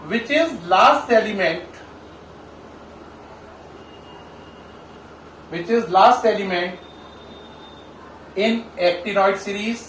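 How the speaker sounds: very slow, spaced-out dictation; slow dictation with long pauses; dictating a question. Real, not acted. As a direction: read it out piece by piece for note-taking. Reads out exam question for copying.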